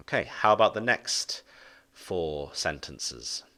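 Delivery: ordinary talk, nothing marked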